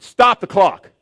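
loud, high furious yell; angry shout. Retold or imitated. Preacher reliving yelling at basketball officials, shouting furiously. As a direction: bark the order as if losing control.

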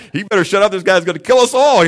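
high, loud panicky mock fear; scared referee. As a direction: play the referee's alarm for laughs. Imagined scared referee's thought.

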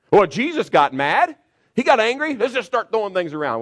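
high, loud mocking voice of someone excusing anger; mock excuse. Preacher mocking excuses for anger, in a loud high sneer.

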